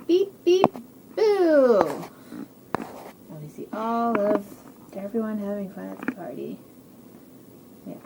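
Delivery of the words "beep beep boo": high, loud beeps and long boo; beep, then spring a playful scare; playful truck boo. Acted.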